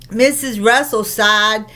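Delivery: ordinary delivery, nothing marked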